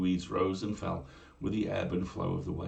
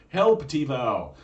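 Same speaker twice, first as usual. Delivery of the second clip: loud, slow rallying shout; battle cry; 6 semitones higher, 10 dB louder, much slower than usual. Acted. Warrior calls the mermaid's name in battle, loud shout.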